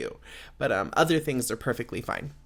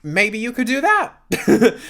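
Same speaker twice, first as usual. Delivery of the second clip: higher and louder, laughing through the roast; laughing mock roast; 6 semitones higher, 7 dB louder than usual. Roasting old coworkers to the listener, higher and louder, laughing. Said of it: "I'm like".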